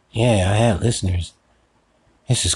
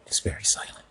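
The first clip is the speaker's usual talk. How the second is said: whisper; 10 dB quieter than usual. Acted. Noting the silence.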